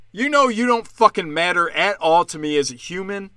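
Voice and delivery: normal talking voice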